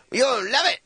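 put-on high, slurred drunk pirate-like voice; drunk stuntman voice. Drunk stuntman talks to the camera in a high slurred voice. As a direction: play the drunk guy pitching his stunt, way up high. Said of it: "and he goes".

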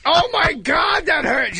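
high, slow pained shout; mock pained shout. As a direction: yell out in hammy pain. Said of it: "and you're like".

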